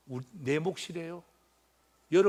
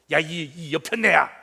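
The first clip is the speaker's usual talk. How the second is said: mock angry husband; 8 dB louder than usual. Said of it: "이렇게 하는 게 아니라".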